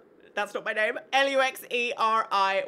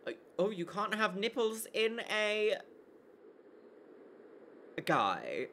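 ordinary talk

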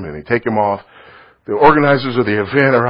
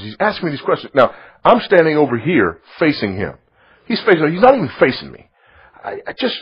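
normal talking voice

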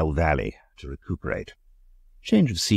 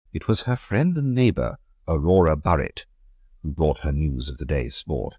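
ordinary delivery

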